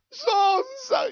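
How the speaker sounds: very high, wailed-out whine; hammed-up tearful bawl; mock crying. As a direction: wail it like a crybaby. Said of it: "¿Y por qué lloras?".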